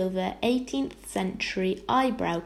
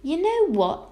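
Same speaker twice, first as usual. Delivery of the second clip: high, loud burst of eagerness; suddenly excited child's exclamation; 9 semitones higher, 5 dB louder than usual. A narrator's reading. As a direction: blurt it out with a new idea. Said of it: "exclaimed Arrietty suddenly".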